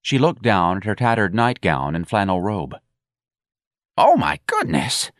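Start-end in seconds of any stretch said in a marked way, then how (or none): 4.0-5.1 put-on slow, high wheezy old woman; wheezing. A narrator's reading. Elderly employee noticing her tattered nightgown, wheezing. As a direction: wheeze it out slowly in dismay. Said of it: "she wheezed".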